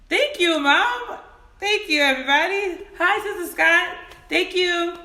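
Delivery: real happy thank-yous; excited thanks. Real, not acted. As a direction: gush thanks to the chat. Thanking viewers in chat.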